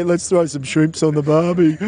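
mocking a cheesy Australian accent; a touch of a tourist Australian accent, teasing; imitating a fake Australian. Teasing her co-host's fake Aussie, in a mild mock Australian accent. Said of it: "Next minute you'll say".

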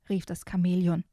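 put-on lively storybook reading for small kids; reading to children. Host reading a children's book excerpt to young listeners, storybook voice. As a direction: read it like a bedtime picture book.

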